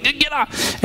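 high, strained and choked; strained high plea. Retold or imitated. Comedian imitating himself choked by his kid, high strained. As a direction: squeeze it out like you're being choked. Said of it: "and he's like".

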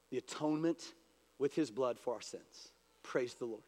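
ordinary delivery

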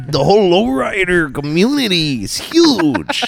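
high, singsong mock Mexican accent. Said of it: "But they talk like that".

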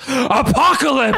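hammed-up dramatic cry, high and slow; [name]. [name] turning to the camera, dramatic cry. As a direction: stretch the name out high in mock drama. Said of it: "dramatically and goes".

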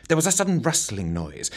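ordinary delivery

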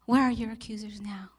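soft whispered question, asked twice. Acted. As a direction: whisper it close and tender. Jesus speaks gently to woman.

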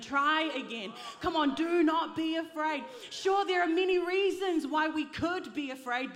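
loud, driving preacher's urging; rousing exhortation. Real, not acted. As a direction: urge them on loudly, phrase after phrase. Exhorting congregation.

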